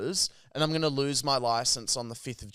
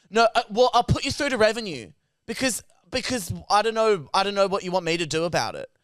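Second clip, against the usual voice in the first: higher and louder, speeding up irritably; mock-snappy call-centre worker; snappy operator; 5 semitones higher, 4 dB louder than usual. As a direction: snap back at a confused caller. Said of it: "he got really snappy".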